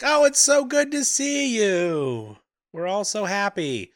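high, drawn-out mock gushing; gushing welcome. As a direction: fawn over him in a sing-song high voice. Everybody fawns over returning Aragorn.

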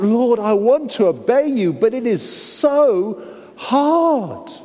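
a believer straining to obey; anguished cry. Acted. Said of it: "we cry out".

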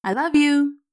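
put-on boy saying goodbye to his dad, smiling; with a smile. A narrator's reading. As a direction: beam as you say it. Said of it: "Will said with a smile".

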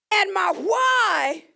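shouted, high, loud preacher's cry; preaching at full force. Acted. Pastor declaring to God, shouting high and loud. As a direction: preach it out, raising the roof.

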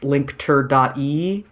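"linkter dot E" read as "link-ter", then dot and the letter E; literal spelling pronunciation. Shown as a demonstration. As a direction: sound out the web address literally as "linkter dot E". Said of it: "that's how I read it in my head".

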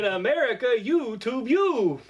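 put-on hammy Russian accent; bad Russian accent. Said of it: "bad Russian accent".

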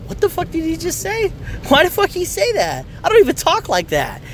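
high-pitched stunned outburst; shocked disbelief. Retold or imitated. Dad reacts to son's line, high and stunned. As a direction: react like you can't believe your ears. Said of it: "And I'm like".

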